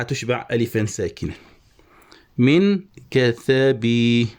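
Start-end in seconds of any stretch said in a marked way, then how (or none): 2.4-4.3 very slow "من كثبي" ending on a long ee; lengthened final vowel. Shown as a demonstration. Teacher showing the saturated kasra, slow scansion. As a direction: stretch the last vowel of "كثبي" into a full ya. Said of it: "طبعًا الكسرة نشبعها".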